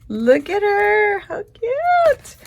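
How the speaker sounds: cooing at a cute sticker for viewers; high-pitched coo. Real, not acted. Gushing over sticker page.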